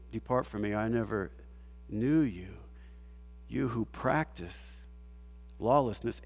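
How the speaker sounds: low, soft, grave voice of judgment; quiet, low judgment, slowing toward the end; solemn quiet judgment. Retold or imitated. Quoting Jesus' judgment.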